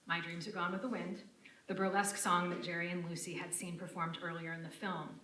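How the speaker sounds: usual speaking voice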